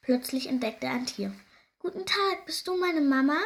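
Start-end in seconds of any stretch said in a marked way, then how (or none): 1.8-3.4 put-on high, childlike hopeful question; little hare. A narrator's reading. Baby hare asking a squirrel, high childlike voice. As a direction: ask in a raised little hare voice. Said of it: "fragt Konstantin das Eichhörnchen".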